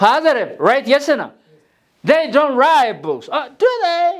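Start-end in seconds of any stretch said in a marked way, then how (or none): 2.0-4.1 slow statement, then a high rising do they; tag question tune. Shown as a demonstration. Teacher showing how a tag question sounds. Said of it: "Even the way you pronounce has to be different".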